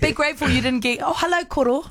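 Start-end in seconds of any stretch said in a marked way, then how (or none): 1.0-1.8 higher-pitched mock greeting with a laugh in it; imitated teacher greeting. Host teases dad with greeting for a grandfather, mock teacher voice.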